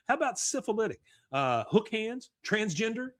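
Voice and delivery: high-pitched acted offer; pleading suggestions. Dealer desperately calling suggestions after him.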